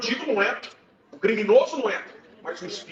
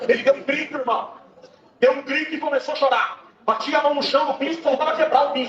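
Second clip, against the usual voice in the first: high, pushed preaching voice; fervent preaching; 7 semitones higher, 4 dB louder than usual. Acted. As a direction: preach the climax with rising fervor. Preaching testimony at full force. (pregação inflamada)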